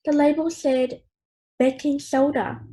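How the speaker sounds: plain reading voice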